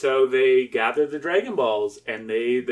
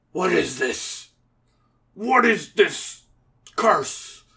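horrified, melodramatic hero voice; dramatic hero; 6 semitones higher, slower than usual. Acted. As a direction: demand it in rising dismay. Acts Devilman looking round, appalled.

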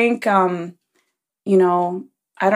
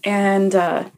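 normal talking voice

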